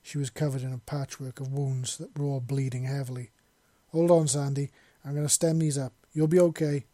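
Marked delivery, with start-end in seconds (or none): 3.9-6.9 urgent, louder reassurance; quickly. A narrator's reading. Soldier urges his wounded partner on, loud and urgent. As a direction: push the words out fast and loud to keep her going. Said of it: "he said quickly".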